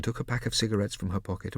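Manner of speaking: usual speaking voice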